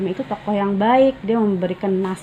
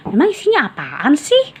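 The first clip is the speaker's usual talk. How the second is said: put-on higher, impatient kid's voice; curious child; 8 semitones higher, 3 dB louder than usual. Fadil asks what is inside, impatient and high. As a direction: ask like an impatient boy.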